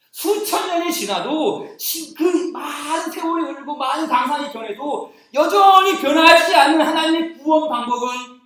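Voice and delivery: high, loud, building proclamation; climbing pulpit fervor; rising preaching climax. Acted. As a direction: drive toward the climax.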